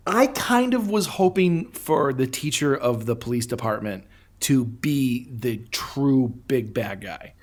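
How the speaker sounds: ordinary talk, nothing marked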